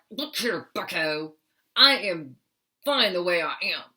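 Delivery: firm acted telling-off, punched in separate bursts; stern. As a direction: put the bully in its place, sternly. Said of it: "it was like a real stern".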